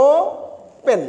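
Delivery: "ওপেন" with a clear gap between ও and পেন; syllables separated. Shown as a demonstration. Teacher demonstrating two syllables, "ওপেন" broken into ও, পেন. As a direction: hold ও, pause, then পেন in "ওপেন". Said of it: "দুইটা syllable".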